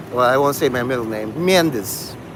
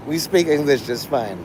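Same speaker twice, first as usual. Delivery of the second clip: ordinary talk, nothing marked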